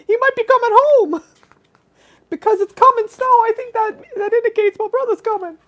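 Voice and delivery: very high put-on voice; fretful story figure. Telling of a brother on set, high put-on fretful voice. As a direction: pitch it way up, like a worried little character.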